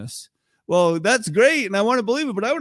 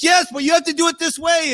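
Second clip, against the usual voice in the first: irritated; 9 semitones higher, 6 dB louder, faster than usual. Retold or imitated. Retold snapping at wife.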